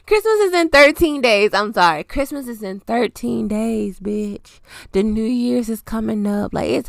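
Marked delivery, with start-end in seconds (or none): 0.1-1.5 emphatic correction. Real, not acted. Correcting the countdown. 2.0-4.6 softer, slower, laid-back restatement; quiet repeat. Real, not acted. Repeating the countdown to listeners, soft slow and dry.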